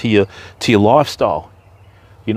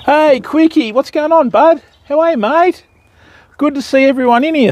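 excited greeting; 14 semitones higher than usual. Real, not acted. Greeting viewers who joined chat.

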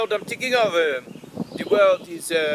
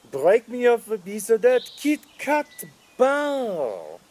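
put-on jingle line in a French accent; mock French accent. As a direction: carry the tune with a French accent.

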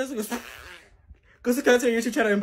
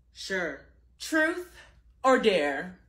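normal talking voice

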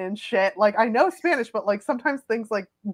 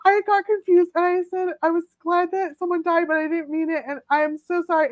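high, pleading retold confession; mock tearful apology; 8 semitones higher than usual. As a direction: imitate a tearful, desperate apology. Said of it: "like, tears".

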